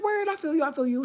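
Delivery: high, put-on voice for the lady upstairs; imitating the neighbor. Mimicking the upstairs neighbor agreeing, high put-on voice. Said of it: "She was like".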